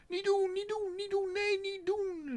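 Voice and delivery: very high, loud pleading; a child begging her father to stop; Otje crying out. A narrator's reading. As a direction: niet doen wailed high and loud. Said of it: "riep Otje".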